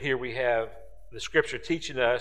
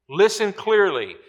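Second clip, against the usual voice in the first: raised urgent call; 6 semitones higher, 5 dB louder than usual. Acted. Preacher urging attention.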